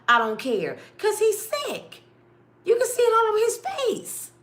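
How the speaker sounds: high, loud, heated rant; outraged. Real, not acted. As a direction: defend him with rising fury. Outraged defence of sick Martin.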